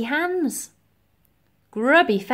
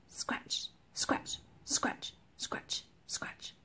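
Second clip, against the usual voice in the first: quick hushed whisper like scraping fingernails; whispered sound effect; 15 dB quieter, much slower than usual. A narrator's reading. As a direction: whisper each scratch. Narrator voices fingernails scraping brickwork.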